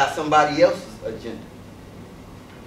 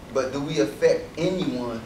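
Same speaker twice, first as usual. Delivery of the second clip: ordinary talk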